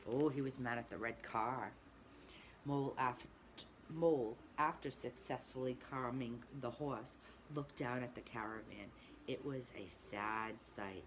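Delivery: usual speaking voice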